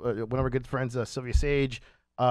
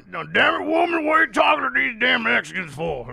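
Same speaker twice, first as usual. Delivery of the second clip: higher mock-angry Southern husband; heavy Southern accent; 8 semitones higher, 4 dB louder than usual. Husband catching wife flirting, heavy mock Southern accent. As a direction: scold in a thick imitated Southern accent. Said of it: "with a very heavy Southern accent".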